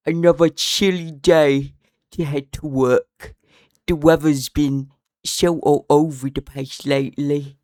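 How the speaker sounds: loud, drawn-out mock British; hammed-up British, slow and loud; silly fake British accent. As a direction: put on the most ridiculous British accent. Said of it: "a British accent ever".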